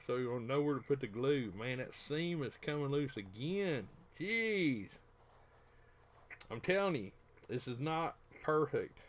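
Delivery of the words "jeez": exasperated. Real, not acted. Frustrated exclamation.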